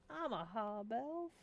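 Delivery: slow sass, lifting at the end; sassy. Shown as a demonstration. Hob Elf answering a tavern patron, slow and sassy. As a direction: draw it out with attitude. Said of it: "Need to say it with sass".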